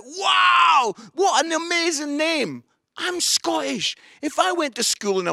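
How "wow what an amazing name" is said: put-on high, loud, gushing American kids; mock excited kids. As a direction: shout the praise like wide-eyed kids. American kids praising the name.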